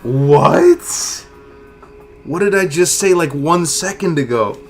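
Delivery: high, loud incredulous outburst; shocked disbelief. Real, not acted. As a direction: yell it in total disbelief. Unwanted recipe drops.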